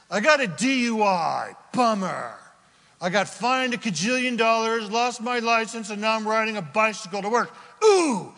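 hammed-up self-pitying complainer; preacher mocking a man sorry for his fine, not his sin; mock complaining sinner. Mocking the remorseful DUI man.

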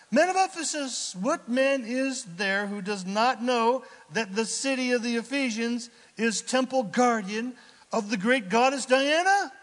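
loud, high proclamation to a crowd; declamatory scripture reading. Acted. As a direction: address the crowd as a city clerk. Reads the city clerk's speech aloud.

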